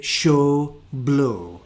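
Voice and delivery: "show", "blow" with a pure Scottish O, no glide; Scottish accent. Shown as a demonstration. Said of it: "I say".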